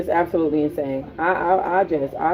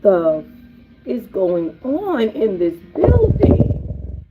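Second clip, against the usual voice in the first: disbelief; 4 semitones higher, much slower than usual. Retold or imitated. Stunned arriving at the chaos.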